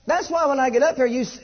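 ordinary delivery

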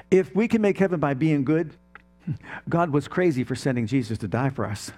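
ordinary talk

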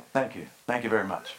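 a hint of [name], low and quick, through a laugh; quick, low [name] mumble, lightly done; mock [name] thank-you. Pastor thanks his wife like [name], lightly mock [name]. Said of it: "give a whatever [name] does".

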